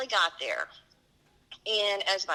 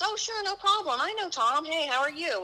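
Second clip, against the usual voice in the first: bright and much higher, friendly welcome; cheery ensign; 9 semitones higher than usual. Retold or imitated. Ensign greeting Mosley's wife at the gangplank, high and cheery. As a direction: greet her like an easygoing sailor, pitched up.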